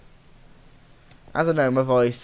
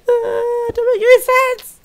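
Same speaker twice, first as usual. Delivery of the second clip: very high squeaky self-mocking voice; silly high voice; 8 dB louder, slower than usual.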